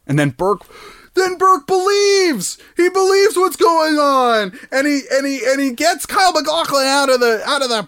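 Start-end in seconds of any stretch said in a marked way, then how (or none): 1.1-7.8 excited climax. Real, not acted. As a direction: tell the big twist at a high pitch. Storyteller reaches the big turn.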